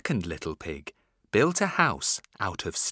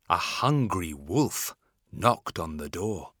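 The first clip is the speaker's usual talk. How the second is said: ordinary delivery, nothing marked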